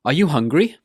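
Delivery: "Are you hungry?" going up at the end; rising intonation. Shown as a demonstration. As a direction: lift the pitch on "hungry?". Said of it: "needs to go up at the end".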